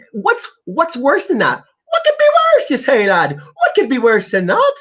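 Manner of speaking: high, loud squawk breaking into laughter; incredulous outburst. Real, not acted. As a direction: shriek it in laughing disbelief. Outraged, laughing rhetorical questions.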